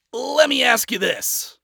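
high, loud, drawn-out retort. A narrator's reading. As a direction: throw it back as a challenge. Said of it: "Adam retorted".

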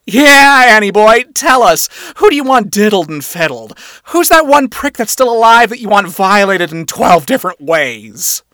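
loud, high, over-the-top boss; wacky boss. A narrator's reading. Boss stealing the spotlight from Moxie, wacky. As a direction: stress the words like a showboating boss. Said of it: "his attempt to be wacky".

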